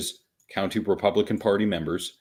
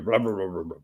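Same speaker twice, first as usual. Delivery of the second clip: mock babble, run together; imitating empty chatter; dismissive blah-blah; 3 semitones lower, 4 dB quieter, much faster than usual. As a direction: wave the quote away in mumbled blahs. Mocking what people will say.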